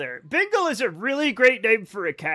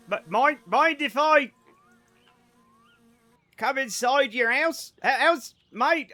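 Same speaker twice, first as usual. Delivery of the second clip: higher, halting, badly faked Australian accent; failed Australian accent; 6 semitones higher, 4 dB louder, slower than usual. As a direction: struggle through an Aussie accent. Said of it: "I can't do an Australian".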